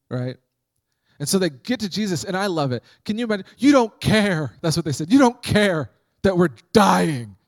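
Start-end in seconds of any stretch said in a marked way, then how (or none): 3.6-4.5 loud, high accusing cry of the disciples. Retold or imitated. Disciples accusing Jesus in the storm, loud accusing cry. 5.1-7.4 loud, high, slow melodramatic wail. Retold or imitated. Disciples accusing sleeping Jesus, melodramatic loud wail.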